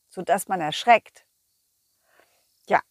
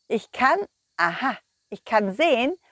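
usual speaking voice

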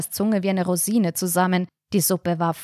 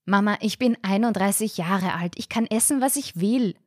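ordinary delivery, nothing marked

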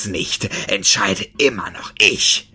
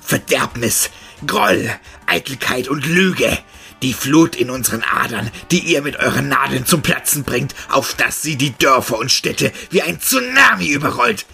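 loud, distorted furious tirade; raging monster roar; 2 semitones higher, 3 dB louder than usual. A narrator's reading. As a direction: boil over with rage. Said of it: "Vor Wut überkochend ... brüllte".

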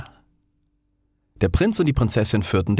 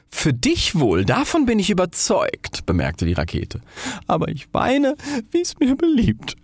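very high, wailing defiance; defiant weepy rocket; 12 semitones higher than usual. Acted. Rocket insists on weeping to the flare, very high and wailing. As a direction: cry because it chooses to.

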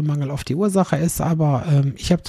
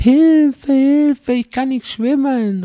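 much higher, louder, strained Hilfe cries; mock drowning cries; 10 semitones higher, 6 dB louder, much slower than usual. Son imitating a drowning man screaming, high and loud. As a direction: wail like a man going under.

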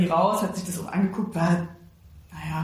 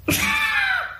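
laughs. Real, not acted. Laughing at own childish act.

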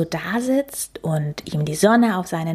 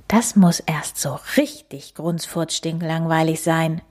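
plain reading voice

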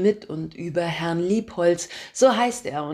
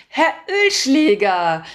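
higher, louder, slow, overly pleased greeting; fake delight; 7 semitones higher, 8 dB louder, slower than usual. Narrator greeting her boss on the phone, faking delight. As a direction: sound thrilled but put it on. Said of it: "mit gespielt erfreuter Stimme".

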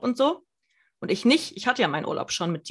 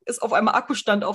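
normal talking voice

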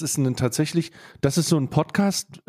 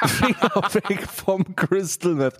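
slow, higher title announcement; slow announcer title; 6 semitones higher, slower than usual. Pretend. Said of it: "Guter Folgenname".

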